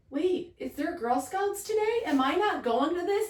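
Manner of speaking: put-on high, puzzled child's voice; imitating child Meg. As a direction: mimic a confused kid asking. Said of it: "Meg's like".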